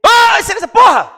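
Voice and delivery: high, loud protest at the judge; retold shout. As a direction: shout the objection at full force. (grito alto de protesto ao juiz)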